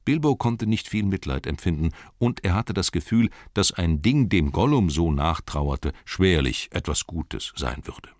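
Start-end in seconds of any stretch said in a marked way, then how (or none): none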